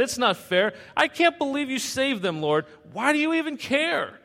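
high, whining protest; huffy complaint. Acted. Jonah complaining to the Lord about Nineveh, high huffy whine. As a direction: huff at the Lord. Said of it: "Jonah huffed and he puffed".

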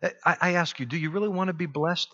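ordinary talk

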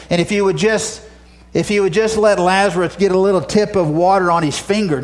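preacher voicing a desperate appeal; pleading for mercy. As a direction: beg as the tormented man. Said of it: "he's crying out for mercy".

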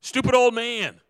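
high, loud mocking yell; shouted insult. A narrator's reading. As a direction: yell it at the old fool from the bank. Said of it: "he shouted to him".

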